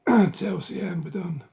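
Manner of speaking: ordinary delivery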